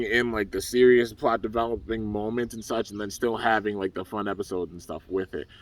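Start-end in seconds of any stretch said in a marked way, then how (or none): none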